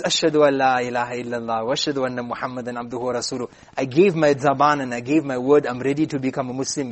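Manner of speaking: low, quick, hushed monotone; soft, hurried profession of faith; rapid recitation. Acted. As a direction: run through the creed quickly and low. King recites the testimony of faith.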